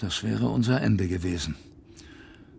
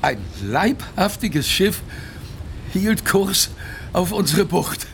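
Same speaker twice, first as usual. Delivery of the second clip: higher, rising with awe; growing excitement; 5 semitones higher than usual. Acted. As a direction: let the joy build. Narrator sees rescue ship.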